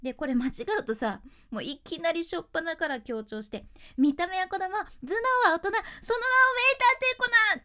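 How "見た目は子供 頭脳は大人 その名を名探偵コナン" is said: whole line yelled, much higher and louder; shouting everything. Shown as a demonstration. Teacher shows the wrong way, shouting the whole line. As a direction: belt every word out with blind force. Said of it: "全体的に声張り上げて".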